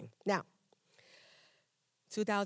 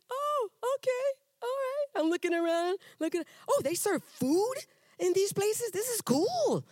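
much higher, slow and wide-eyed; delighted surprise; 14 semitones higher, 3 dB louder than usual. Retold or imitated. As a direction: squeal it in amazed delight. Retold surprise at church breakfast.

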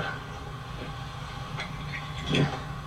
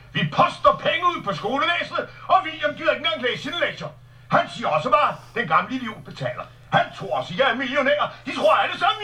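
mock tirade; 9 semitones higher, much faster than usual. Tax rant acted out.

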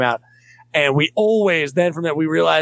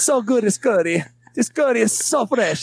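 high-pitched mock Italian accent; 8 semitones higher than usual. Comic imitating an Iowa curry maker, mock Italian accent. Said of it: "I'm gonna do an Italian accent".

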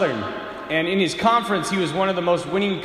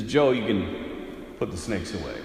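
lower, softer, slow and calm; calm coach; 10 semitones lower, 6 dB quieter, slower than usual. Acted. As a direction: settle the room down quietly. Coach calmly ends the demonstration.

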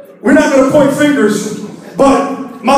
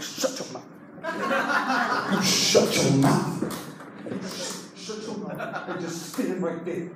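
menacing whispered order; big brother secretly shushing his accomplice; hissed whisper; 7 semitones lower, 12 dB quieter, slower than usual. Acted. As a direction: whisper it through clenched teeth, drawn out. Big brother hushing panicked accomplice.

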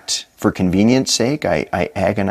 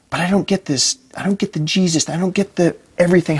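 pleading prayer; 8 semitones higher, faster than usual. Retold or imitated. Pleading prayer to God, pitch up.

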